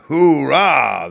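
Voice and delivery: slow, loud crowd cheer; hoarse cheer. A narrator's reading. Crowd cheering for the man with the hat, loud. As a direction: roar it out as a crowd. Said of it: "raised a hoarse cheer".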